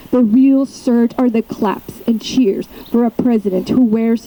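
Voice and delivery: deliberate openings building into insistent refrains; poem reading. Acted. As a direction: perform it as a protest poem, driving the repeated phrases. Recites second poem.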